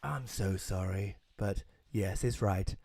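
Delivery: low, slow, soft mock villain reveal; hammed-up vampire owning up quietly; villain confession. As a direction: drop your voice for the sinister confession. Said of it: "he's like".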